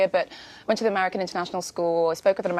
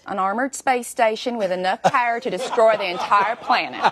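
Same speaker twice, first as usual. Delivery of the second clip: put-on Southern accent; 3 dB louder than usual. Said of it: "Southern United States".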